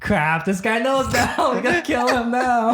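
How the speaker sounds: laughing imitation of panicked henchmen; laughing mock panic. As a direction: play the goons' panic while laughing. Voicing panicked characters.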